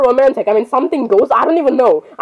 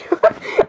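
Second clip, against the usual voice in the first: high squeal of laughter; 3 dB quieter than usual. Real, not acted. Surprised by a song.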